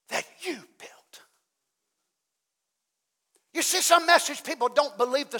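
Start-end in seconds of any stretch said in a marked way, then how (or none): 0.1-1.2 soft unvoiced whisper. Real, not acted. Pastor telling congregation they built churches, whispering. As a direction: breathe it out as a secret.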